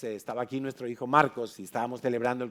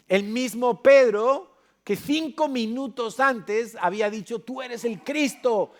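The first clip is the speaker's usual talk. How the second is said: preaching at full force; 5 semitones higher, 5 dB louder than usual. Acted. Preacher telling the congregation about Peter, fervent preaching. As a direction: build the climax, proclaim each title.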